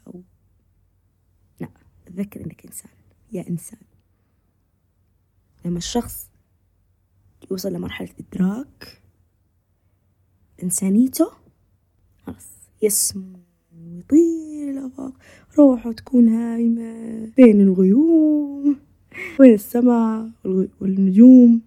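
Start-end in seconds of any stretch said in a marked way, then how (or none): 1.6-3.8 quick, hushed, intimate whisper; whispered refrain. Acted. Speaker repeating her refrain to the listener, whispered. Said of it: "refrain whispered again". 12.2-21.6 slow, airy, rising toward loud; dreamy uplift. Acted. Speaker describing the soul soaring among clouds, dreamy. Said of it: "soaring spiritual imagery".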